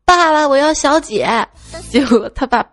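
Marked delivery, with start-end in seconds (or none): 0.0-1.3 loud, high, drawn-out imitation of a kid; child calling dad. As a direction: demand it slowly like a clamoring child. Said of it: "就跟爸爸说". (模仿小孩拖长声音高喊)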